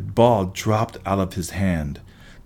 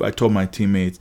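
plain reading voice

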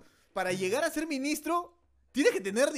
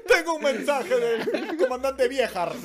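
slow, loud, laughing messenger voice; slow pompous messenger; 6 dB louder, much slower than usual. Retold or imitated. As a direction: announce it like a royal herald. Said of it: "Y llegaba".

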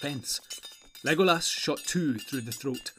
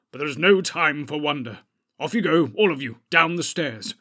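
loud, urgent ordering; brisk command; 7 dB louder than usual. A narrator's reading. Wizard orders company down the stairs, commanding. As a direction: send them off with firm authority.

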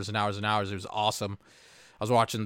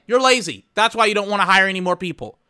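high, loud accusing; angry accusation; 6 semitones higher, 6 dB louder than usual. Retold or imitated. Accusing lazy managers, high and loud. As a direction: throw the accusation in their faces. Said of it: "And I'm just like".

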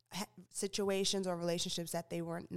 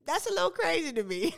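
surprised; 9 semitones higher, 6 dB louder than usual. Real, not acted. Reacting to shocking news.

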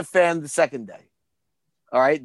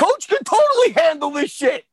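high, shouted hype; retold excited confidence; 9 semitones higher, 3 dB louder than usual. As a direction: shout it like a true believer. Acting out excited faith in Coach.

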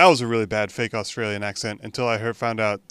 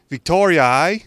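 put-on Australian accent; 6 semitones higher, 9 dB louder than usual. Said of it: "my attempt at an Australian accent".

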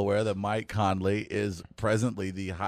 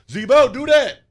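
high loud yell of Zebo; mock crowd call; fan shout; 12 semitones higher, 9 dB louder than usual. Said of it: "favorite shout out from the crowd".

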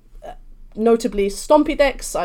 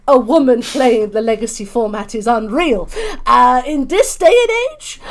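mock outrage; 2 semitones higher, 6 dB louder than usual. Co-host sends up objectors to women playing Legacy, mock outrage. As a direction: play scandalised disbelief, rising and loud. Mocking disbelief at women players.

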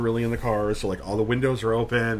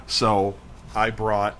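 ordinary talk, nothing marked

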